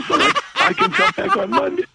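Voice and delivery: cracking up mid-con; laughing through fake laryngitis. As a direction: keep the sick croak but laugh through it. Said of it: "I've got laryngitis".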